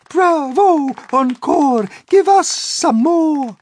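high, loud delighted cheering; shouting bravo. A narrator's reading. Miss Spider cheering the grasshopper's violin playing, loud and high. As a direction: cheer wildly for an encore. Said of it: "Miss Spider stood up and shouted".

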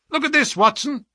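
high, eager call; triumphant cry. A narrator's reading. Holmes shows Watson the newspaper, crying out. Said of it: "he cried".

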